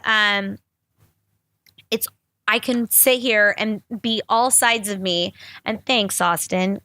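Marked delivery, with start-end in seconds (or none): none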